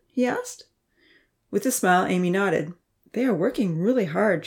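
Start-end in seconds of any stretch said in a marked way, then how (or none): none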